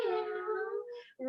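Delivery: a tiny kitty meow; soft meow. Pretend. Mom meows softly to her boys in cat pose.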